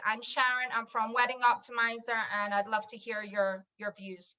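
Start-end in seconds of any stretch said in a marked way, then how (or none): none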